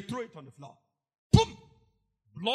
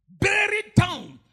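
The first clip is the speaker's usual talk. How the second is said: higher, slow, loud; slow shout; 7 semitones higher, 8 dB louder, slower than usual. Real, not acted. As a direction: drag it out with force. Preaching climax.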